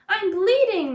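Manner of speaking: a fox waking up and yelping in alarm; yelped. A narrator's reading. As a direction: yelp it in a panic. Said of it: "the fox yelped".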